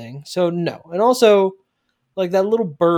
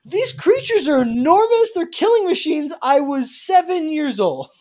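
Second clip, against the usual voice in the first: imitating a boy yelling at his dad, pitched much higher; high, whiny mock shout of a kid; hammed-up teenage yell at his father; 11 semitones higher, 3 dB louder than usual. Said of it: "When he's yelling at his dad".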